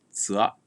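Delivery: zua with the final a whispered; "zua" ending in a breath of a; whispered final vowel. Shown as a demonstration. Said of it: "or be whispered".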